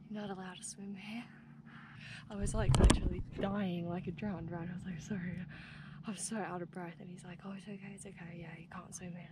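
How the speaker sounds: hushed telling. Real, not acted. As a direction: keep it down like someone might overhear. Retelling quietly after being told off.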